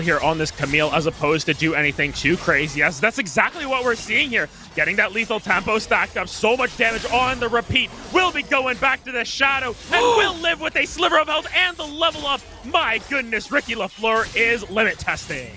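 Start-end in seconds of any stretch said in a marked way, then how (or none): 2.8-12.4 excited play-by-play. Real, not acted. Caster calling a close escape live, rising excited shout. Calls a climbing live fight. 12.6-15.5 amazed play-by-play. Real, not acted. Amazed after a narrow escape.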